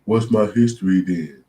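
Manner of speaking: high, slow whiny plea; feeble baby. Retold or imitated. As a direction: play a helpless baby asking. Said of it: "like a feeble baby".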